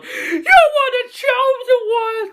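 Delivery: high, wailing mock-anguished cry; slow, hammed-up squeal of heartbreak; Obi-Wan. Obi-Wan to defeated Anakin, hammed-up anguish. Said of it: "He goes".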